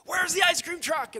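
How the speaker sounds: high, full-volume holler; hammed-up screaming question; frantic comic yell. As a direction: yell it like a kid desperate for ice cream. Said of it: "so calmly and quietly".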